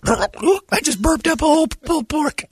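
high, slow mock shout. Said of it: "talking to me once and gone".

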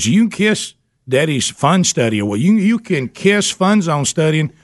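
put-on winding up a playful tirade at the kid; mock scolding. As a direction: pitch climbing into a hammed-up dad rant. Dad scolding son in retold story.

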